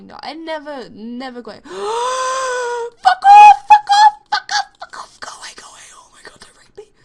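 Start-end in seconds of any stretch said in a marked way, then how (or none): 1.6-4.3 very high, very loud, crying shriek; hammed-up victim screams at attacker; mock panicked scream. 4.3-6.9 hushed, breathless terrified pleading; hammed-up victim begs, fading to a whisper; frantic whisper.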